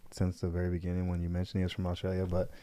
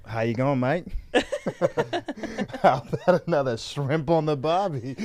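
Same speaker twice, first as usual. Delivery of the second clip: put-on a lightly done, laughing Aussie accent; loud, playful Aussie greeting to Julian; Australian accent; 6 semitones higher, 5 dB louder than usual. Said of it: "Julian, if you're listening to this".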